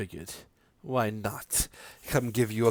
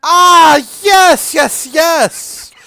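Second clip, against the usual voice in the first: high, loud, thrilled yes; delighted squeal; 14 semitones higher, 11 dB louder, much slower than usual. Acted. Mother hears Aaron is rich, squealing high and loud.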